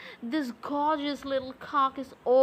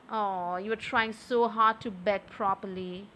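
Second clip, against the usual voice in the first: ordinary talk, nothing marked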